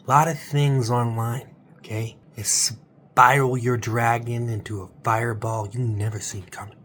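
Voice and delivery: ordinary talk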